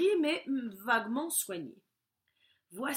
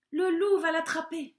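higher, louder alarmed cry; worried cry; 6 semitones higher, 8 dB louder than usual. A narrator's reading. Valentin fearing the wolf will catch her. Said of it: "se dit Valentin".

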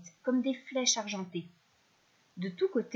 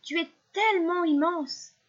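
higher, louder, marvelling call; amazed exclamation; 7 semitones higher, 5 dB louder than usual. A narrator's reading. Little fish calls to the huge whale from afar, amazed. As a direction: lean on the wonder, voice lifted.

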